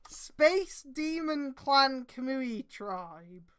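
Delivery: very slow questioning repeat; incredulous. Real, not acted. Reader repeating the silly clan name, incredulous. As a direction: spell it out in disbelief.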